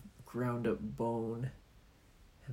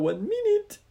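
very high, loud monster squeak; high squeaky Oni voice; 6 dB louder than usual. Acted. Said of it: "that's the Oni's voice".

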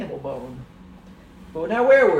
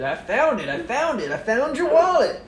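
rising louder, triumphant; excited announcement; 9 semitones higher, 10 dB louder than usual. Acted. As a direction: build up to a proud shout. Detective proudly announcing find.

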